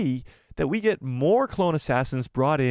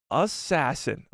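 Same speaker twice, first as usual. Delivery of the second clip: ordinary delivery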